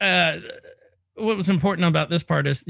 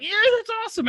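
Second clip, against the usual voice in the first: much higher, gushing cheer; mock excited; much faster than usual. Recalling cheering at movies, much higher excited squeal. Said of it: "when I get really excited in a movie".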